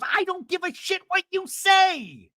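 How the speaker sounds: high, loud, drawn-out screech; hammed-up quarrel shout; imitated angry yell. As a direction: yell back in mock fury. Acts out Alexis yelling back.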